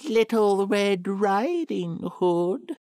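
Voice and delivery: high, slow fake little girl; wolf mimicking a child; wolf faking girl. A narrator's reading. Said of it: "replied the wolf".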